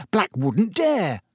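high, slow and defiant; spirited. Acted. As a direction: bold and spirited. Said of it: "said Ron spiritedly".

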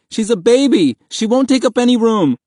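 high, loud frantic pleading; begging. A narrator's reading. Boy pleading for his baby sister, frantic cry. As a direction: argue it desperately at a high pitch. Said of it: "Mahmud begged".

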